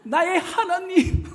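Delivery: a touch of mock weeping that dissolves in a laugh; trembling, breaking into a laugh. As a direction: try to sound tearful but laugh. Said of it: "표현도 잘 못하겠네요".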